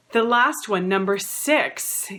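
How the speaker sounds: usual speaking voice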